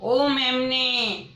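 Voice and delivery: long drawn-out calling shout; lion calling the lamb loudly. A narrator's reading. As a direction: call out loud across the jungle. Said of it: "जोर से आवाज़ लगाई".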